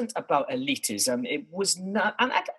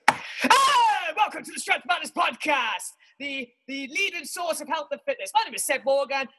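much higher, hammed-up manic announcer voice; wild host intro; 10 semitones higher than usual. Host kicking off the first episode, manic higher announcer yell. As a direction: blast the welcome like a gleeful madman. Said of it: "Okay, press play".

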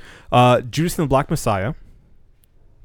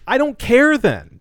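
dismissive; 12 semitones higher, 5 dB louder than usual. Retold or imitated. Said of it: "And I'm like".

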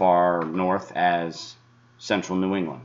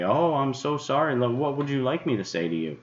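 mock groveling; 7 semitones higher than usual. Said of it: "grovel to your feet and say".

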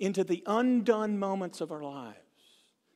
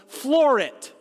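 urgent command; 4 semitones higher, 8 dB louder than usual. Retold or imitated. Urgent driving instruction to student.

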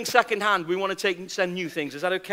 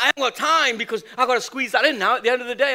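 rushed excuse; 2 semitones higher, much faster than usual. Retold or imitated. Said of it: "I'm like".

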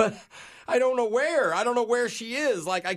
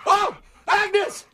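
excited shout; 8 semitones higher, much slower than usual. Pretend. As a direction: shout with mock joy as if the dog just appeared. Finds the lost dog.

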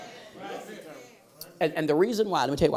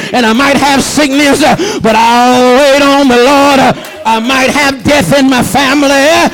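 high, loud whooping preacher cadence; chanted, shouted sermon climax; full-force preaching; 7 semitones higher, 5 dB louder than usual. Real, not acted. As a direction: preach it out like Sunday at home. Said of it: "I was preaching like I'm at home".

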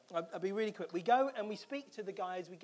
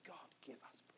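whispered plea to God; barely audible, earnest petition; retold prayer; 7 semitones lower, 20 dB quieter than usual. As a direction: breathe it as a very low whispered prayer. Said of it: "I was praying".

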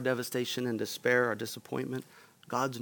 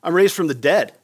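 louder, impatient mock rebuke; mock scolding; 3 semitones higher, 10 dB louder than usual. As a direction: scoff and tell her to cut it out. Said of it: "we shouldn't read that as a scolding".

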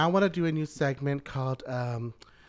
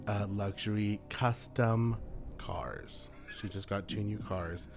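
whisper; 5 semitones lower, 8 dB quieter, slower than usual. Real, not acted. Gossip, whispered aside.

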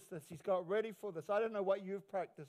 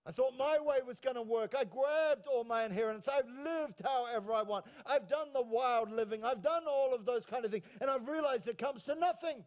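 driving, raised preacher's delivery; impassioned preaching; 4 semitones higher, 4 dB louder than usual. Acted. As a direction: preach the son's confession with force. Preacher voices the returning son.

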